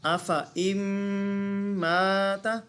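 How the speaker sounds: drawn-out tajweed recitation of the verse; Quranic recitation. Shown as a demonstration. Teacher demonstrating recitation to students. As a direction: recite it slowly as in Quran reading. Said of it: "जी, कैसे पढ़ेंगे?".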